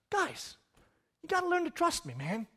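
high, hushed and laid-back; quiet, unworried reproach; soft, easygoing chiding. Acted. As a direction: gently chide your friends. Sleepy Jesus reassures the disciples.

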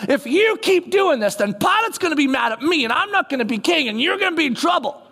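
high, anxious mock rant; agitated Herod. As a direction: whine it like a nervous king. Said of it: "And Herod goes".

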